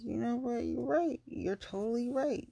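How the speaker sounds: high-pitched, conceding the point; emphatic agreement. Retold or imitated. Retelling how she agreed with his advice, emphatic and high. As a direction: agree wholeheartedly, pitched well up.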